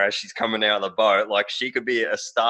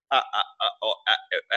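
choppy internet; 3 semitones lower, 4 dB quieter, faster than usual. Retold or imitated. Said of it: "your internet just slowed right down".

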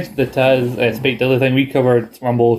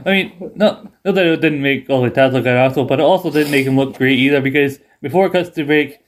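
ordinary talk, nothing marked

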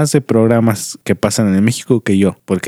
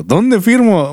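playfully smitten, much higher; mock swooning; 10 semitones higher, much slower than usual. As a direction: pretend to fall for the accent instantly. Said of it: "me hablan en ese acento y yo digo". (¿Dónde firmo? encantada en broma, mucho más aguda)

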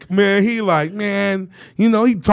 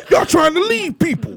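higher whiny offended tone; mock offended; 7 semitones higher than usual. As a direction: whine it like he's been abandoned. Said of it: "That's what he said".

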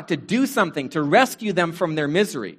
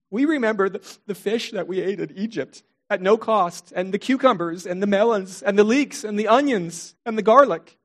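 mock blubbering; 4 semitones higher than usual. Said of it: "Through their blubbering and through their tears".

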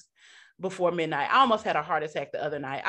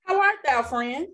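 much higher, louder prim grown-up voice; big girl voice; 7 semitones higher, 5 dB louder than usual. Pretend. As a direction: put on a fancy big-girl voice. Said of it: "let me put my big girl voice on".

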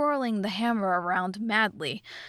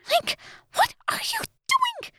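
very high, squeaky fairy voice, drawn-out words; urgent fairy; 3 dB louder than usual. A narrator's reading. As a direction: urge him in a tiny high-pitched voice. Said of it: "she whispered urgently".

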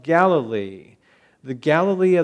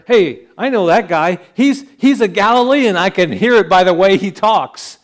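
excited recognition; 4 semitones higher, 7 dB louder than usual. Acted. Jerusalem people recognising a Galilean.

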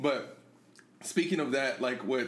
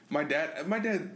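ordinary talk, nothing marked

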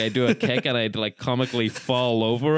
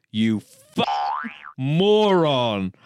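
angry shout; much slower than usual. Retold or imitated. Roommate snapping over a popped beach ball, imitated anger. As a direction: spit out each word in acted anger. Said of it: "I didn't shout it at you that angrily".

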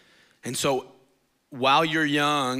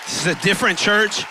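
higher, louder, slow rising proclamation; full-force preaching; 8 semitones higher, 3 dB louder than usual. Acted. Pastor honoring church leaders, preaching loud and high. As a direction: preach it to the whole room.

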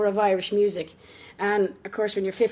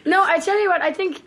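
laughing; 9 semitones higher, 4 dB louder than usual. Real, not acted. Laughing after the foghorn joke.

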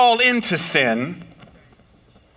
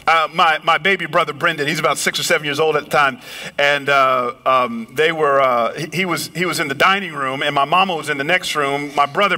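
ordinary talk, nothing marked

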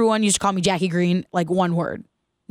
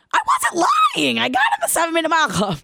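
indignant protest; 8 semitones higher than usual. Retold or imitated. Girl protesting to a teasing classmate, high and indignant. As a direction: insist hard, voice shooting up. Girl defending herself, upset.

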